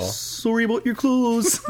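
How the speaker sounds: high, slow Canadian apology; mock Canadian accent. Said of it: "is that a good Canadian one".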